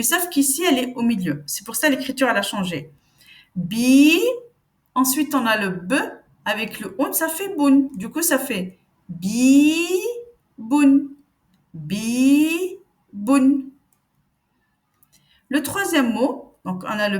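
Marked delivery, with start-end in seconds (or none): 3.5-4.2 "Bi" said clearly on its own; model syllable. Shown as a demonstration. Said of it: "je vais venir prononcer chaque lettre". 10.6-13.7 "Bi", "boun" said carefully apart; model syllables. Shown as a demonstration. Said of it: "je vais venir prononcer chaque lettre".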